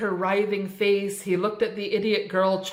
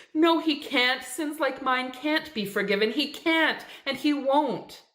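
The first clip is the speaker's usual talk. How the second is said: much higher, hopeless insistent denial; despairing refusal; 7 semitones higher than usual. A narrator's reading. Dying Naomi rejects the minister's hope, despairing.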